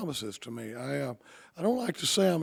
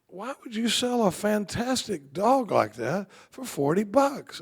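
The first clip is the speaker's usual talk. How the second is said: incredulous; 6 semitones higher, 4 dB louder, slower than usual. Retold or imitated. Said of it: "And he goes".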